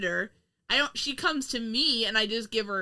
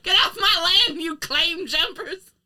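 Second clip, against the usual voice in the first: put-on grumpy old prospector; 8 semitones higher, 8 dB louder than usual. Acting the old gold miner.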